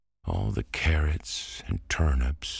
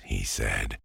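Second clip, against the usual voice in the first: ordinary delivery, nothing marked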